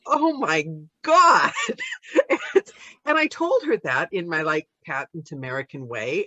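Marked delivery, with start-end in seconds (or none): 0.1-1.5 slow, loud, high cry of shock; real amazement, half-shouted. Retold or imitated. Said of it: "But I was shocked". 1.6-2.6 shocked laugh. Retold or imitated. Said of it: "But I was shocked".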